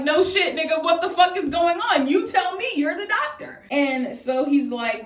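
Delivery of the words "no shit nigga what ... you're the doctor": high-pitched, exasperated comeback; indignant retort. Retold or imitated. Patient answering laughing doctor, indignant high retort. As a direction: throw it back at him in high disbelief.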